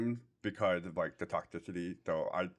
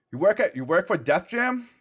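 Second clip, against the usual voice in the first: loud, higher call across the street; shouting stranger; 8 semitones higher, 6 dB louder than usual. Retold or imitated. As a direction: shout it from the next car over. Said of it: "he's like".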